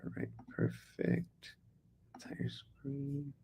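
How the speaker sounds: whispered under the breath; whispering. Real, not acted. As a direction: whisper it to yourself. Mutters to self sharing screen.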